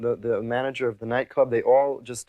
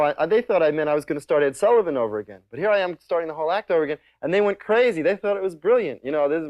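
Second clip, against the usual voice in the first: ordinary talk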